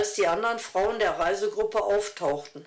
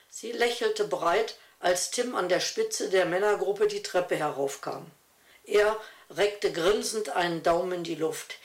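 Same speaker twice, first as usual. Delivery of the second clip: ordinary delivery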